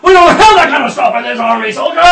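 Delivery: loud high barking sergeant; drill sergeant. Acted. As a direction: yell it at a recruit. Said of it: "the drill sergeant then just starts".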